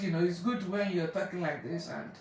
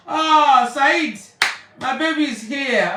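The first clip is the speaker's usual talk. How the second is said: loud, high, overjoyed hello; delighted greeting; 5 semitones higher, 10 dB louder, slower than usual. Real, not acted. Greeting her father on the live stream with loud joy. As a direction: greet them like long-lost family.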